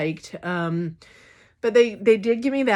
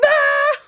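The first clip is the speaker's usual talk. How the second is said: sudden high yelp, much higher and louder; a squeaky fake "ah!" of alarm; mock startled yelp; 6 dB louder than usual.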